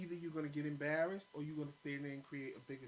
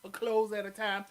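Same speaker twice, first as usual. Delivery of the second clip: mocking; 5 semitones higher, 11 dB louder than usual. Real, not acted. Mocking husband's slow packing.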